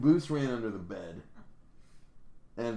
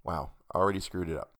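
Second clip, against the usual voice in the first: ordinary delivery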